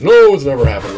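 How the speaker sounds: loud, scoffing "no, it never happened"; mock the sceptics' flat denial; dismissive denial. Retelling opposing view of scientists.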